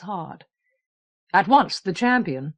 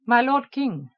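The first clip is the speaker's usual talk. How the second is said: alien voice; 3 semitones higher than usual. Acted. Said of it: "he said in an alien voice".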